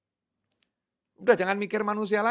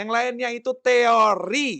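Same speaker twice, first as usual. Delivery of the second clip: emphatic proclaim; 6 semitones higher, 7 dB louder, slower than usual. Real, not acted. Preacher contrasting theory and law.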